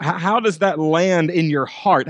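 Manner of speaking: normal talking voice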